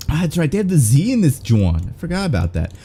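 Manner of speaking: usual speaking voice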